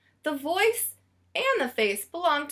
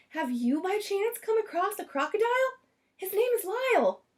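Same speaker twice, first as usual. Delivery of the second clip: frantic Missus Prim; 8 semitones higher than usual. A narrator's reading. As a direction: urgently catch the clerk's attention. Prim frantically searching for Lyle.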